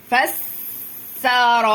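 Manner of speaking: فسر with a long hissed س, then loud held vowels; teacher stretching every syllable of فسر; drawn-out syllables. Shown as a demonstration. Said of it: "ليه بقى خدت واحدة من هنا".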